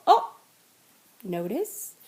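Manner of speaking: ordinary talk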